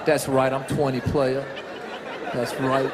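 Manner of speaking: low, laid-back player voice; cool twenty-year-old. Retold or imitated. Comic imitating a clapped-for twenty-year-old, low cool voice. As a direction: drop it low and play it cool. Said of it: "She's like this".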